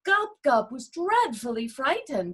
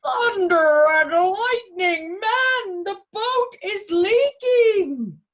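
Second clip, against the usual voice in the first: higher, louder, slow alarmed bellow; 8 semitones higher, 7 dB louder, slower than usual. A narrator's reading. Pirate leader discovering his boat leaks, bellowing in alarm. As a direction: roar it out in sudden panic. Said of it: "suddenly bellowed out".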